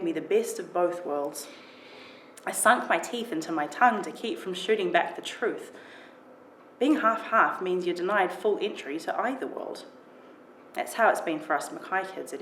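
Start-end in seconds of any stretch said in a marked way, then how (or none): none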